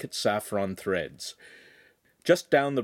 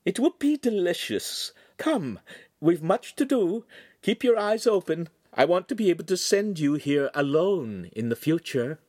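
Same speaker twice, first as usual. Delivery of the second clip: much higher, gently refusing lady; a refined lady politely declining; signora's voice; 9 semitones higher, 3 dB louder than usual. Acted. Signora turning down the butcher's beef heart, much higher.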